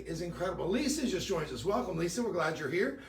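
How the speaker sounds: usual speaking voice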